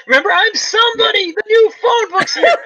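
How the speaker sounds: high, shouted, overjoyed movie line; ecstatic shout. Retold or imitated. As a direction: yell it like the best news ever. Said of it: "brings up".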